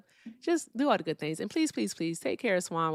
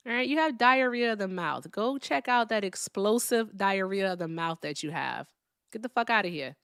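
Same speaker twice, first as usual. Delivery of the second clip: usual speaking voice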